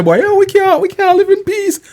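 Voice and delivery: high-pitched mock complaining; mock complaint. Host imitates women saying they can't take it, mock complaint. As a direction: put on a high, plaintive imitation.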